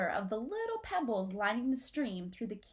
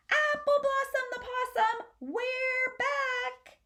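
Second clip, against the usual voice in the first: high, loud, grand actor's announcement; commanding stage shout; 5 dB louder, slower than usual. Acted. Possum actor announcing their return, commanding stage shout. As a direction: boom it out like a ham actor. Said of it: "shouts using his full acting voice".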